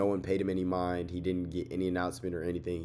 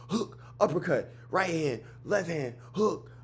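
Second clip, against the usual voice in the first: high-pitched, rapid-fire list of strikes; excited punch call-out; 8 semitones higher than usual. Retold or imitated. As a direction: call each punch higher, mimicking a wild flurry. Calling out wild punches in flurry.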